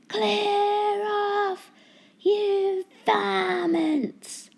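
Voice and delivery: high, loud yell at the seagulls; angry shout. A narrator's reading. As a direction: shoo the gulls off at full voice. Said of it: "shouted Mister Grinling".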